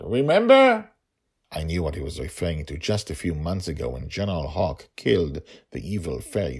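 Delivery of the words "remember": high, loud question; pressing shout. A narrator's reading. Father reminds daughter of the lesson, loud pressing call. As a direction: drive it home.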